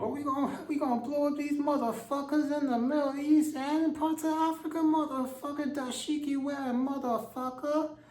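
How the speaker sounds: high, cartoonish tough-guy voice; mock warmonger voice. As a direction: crow it like a squeaky action villain. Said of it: "some of them talk like this".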